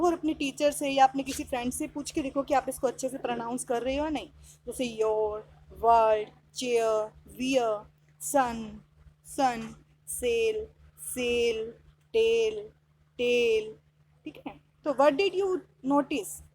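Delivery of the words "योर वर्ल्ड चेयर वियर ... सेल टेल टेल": word list read out slowly, each word separate and lower; lower, measured teacher's model of each word; pronunciation model. Shown as a demonstration. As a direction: say each word alone, evenly, with a falling tone. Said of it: "आप इनको loudly आवाज़ में बोल के देखो".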